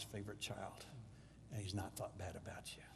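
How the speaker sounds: soft secret whisper of comfort. Acted. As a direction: breathe it to him like a secret. Intimate assurance to pastor.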